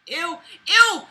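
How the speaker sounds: high, loud disgusted ew. Real, not acted. Disgusted outburst at the diary's bragging. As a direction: recoil with a loud ew.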